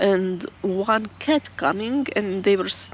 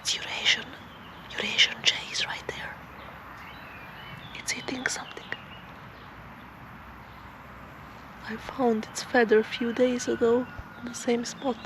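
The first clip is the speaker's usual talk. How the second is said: whispering; 8 dB quieter than usual. Real, not acted. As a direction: whisper so as not to disturb the bird. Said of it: "I don't know why I'm whispering".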